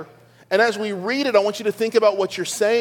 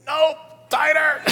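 high, strained imitation of an insistent child; Ella; 9 semitones higher, slower than usual. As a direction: squeeze it out slowly like a demanding little girl. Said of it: "My daughter Ella, who's nine".

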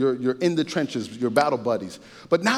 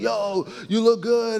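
higher, slow, drawn-out "you look good"; admiring friend; 8 semitones higher, slower than usual. Retold or imitated. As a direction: hype him up, lingering on it. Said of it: "they're like".

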